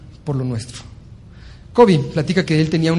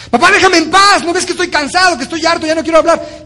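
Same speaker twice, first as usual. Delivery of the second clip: much higher and louder, yelling at dad; angry teen shout; 10 semitones higher, 6 dB louder than usual. Acted. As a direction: blow up at your father. Son shouts at father, second take. (hijo gritándole furioso al papá)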